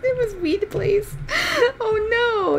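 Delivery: high, cracking with laughter; amused, laughing in disbelief. Real, not acted. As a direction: giggle through the name. Laughing at joke name.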